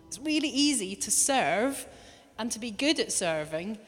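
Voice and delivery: usual speaking voice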